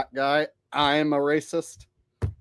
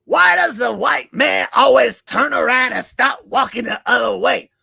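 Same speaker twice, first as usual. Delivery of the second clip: Chris Rock; 4 semitones higher than usual. Acted. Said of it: "Haven't done Chris Rock in a while".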